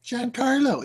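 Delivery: Giancarlo lifted high and soft; high mock Giancarlo. Shown as a demonstration. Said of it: "listen to you going up a couple octaves".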